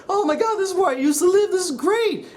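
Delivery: high-pitched mock glee; cheery and happy. Avatar returning to the temple, mock cheery. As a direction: gush happily in an exaggerated high imitation. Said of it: "he's all cheery and happy".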